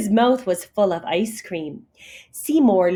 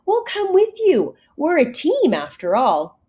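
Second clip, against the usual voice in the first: eager; 8 semitones higher than usual. A narrator's reading. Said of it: "Kitten cried".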